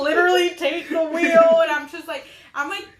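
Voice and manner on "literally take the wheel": comic shout. Retold or imitated.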